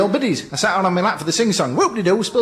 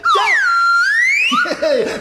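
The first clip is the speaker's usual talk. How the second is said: ghostly laugh. Acted. Ghost prank cackle.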